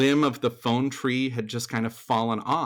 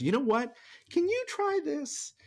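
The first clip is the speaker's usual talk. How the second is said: much higher, bright inviting voice of a casting director. Retold or imitated. Casting director offering a new part, high and inviting. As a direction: lift the pitch way up like a friendly casting director. Casting person suggests a role.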